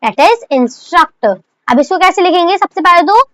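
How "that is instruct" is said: the word drawn out very slowly; slow dictation. Shown as a demonstration. Teacher dictating instruct slowly to her class. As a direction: spell the word out slowly for students. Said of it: "हमारे पास एक word है".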